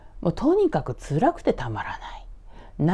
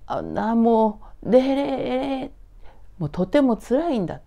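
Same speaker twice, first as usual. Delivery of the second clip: pleading son; 6 semitones higher, 4 dB louder than usual. Acted. Son pleads, overwhelmed, to mother.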